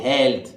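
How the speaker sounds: a clear model of हेल्थ; model word health. Shown as a demonstration. Teacher modelling हेल्थ for students. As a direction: say हेल्थ with a short e, as helth. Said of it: "तो ए की मात्रा लगती है".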